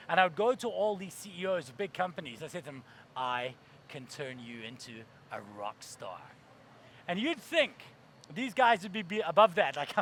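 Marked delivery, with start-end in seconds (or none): 3.2-6.2 very low, slow and soft; hushed sales pitch. Retold or imitated. Young founder pitches CEOs, slow low allure. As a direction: drop it deep and draw out each phrase.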